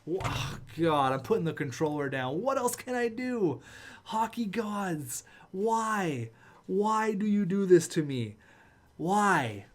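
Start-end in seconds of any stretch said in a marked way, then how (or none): 2.4-9.7 crying out to the heavens. Real, not acted. Gamer wailing at the hockey gods in despair. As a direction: wail at the sky.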